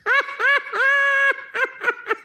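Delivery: high-pitched burst of laughter. Real, not acted. Laughing at own joke.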